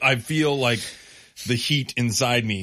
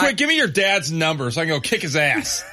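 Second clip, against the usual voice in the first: laughing joke; 6 semitones higher, 4 dB louder than usual. Real, not acted. Co-host jokes, laughing.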